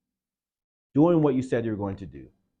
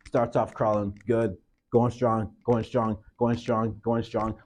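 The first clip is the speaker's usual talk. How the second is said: ordinary talk, nothing marked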